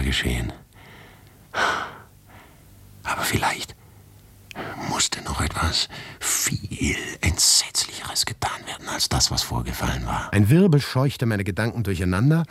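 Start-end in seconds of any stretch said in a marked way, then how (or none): 3.0-10.3 whispered thought. A narrator's reading. Narrator whispers what horror must still be done.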